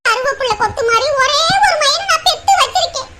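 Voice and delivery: tearful lament building to a high wail; acted weeping, climbing in pitch; crying. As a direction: break down crying as you pour out your troubles. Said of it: "ஏன் இப்படி அழுதுகிட்டு இருக்கீங்க?".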